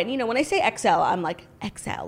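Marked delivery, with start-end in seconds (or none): 1.6-2.1 low, hushed delivery; kinda quiet. Retold or imitated. Shy shopper discreetly giving a clothing size, very quietly. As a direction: say it low and under your breath. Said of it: "it was like, kinda quiet".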